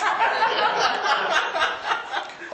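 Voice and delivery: a big high burst of laughter. Real, not acted. Laughs at his own joke.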